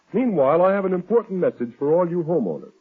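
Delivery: usual speaking voice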